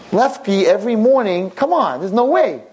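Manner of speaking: high, insistent protest; acted incredulous outburst; indignant disbelief. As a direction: argue back, refusing to believe it. Blind man refuses to believe nurse.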